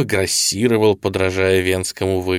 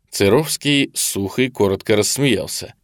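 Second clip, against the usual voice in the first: ordinary delivery, nothing marked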